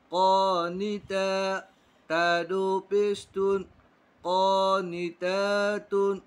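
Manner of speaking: slow, drawn-out recitation of syllables; Quran spelling drill. Shown as a demonstration. Teacher drilling pupils in Quran spelling, slow chant. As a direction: spell it out syllable by syllable, stretching long vowels.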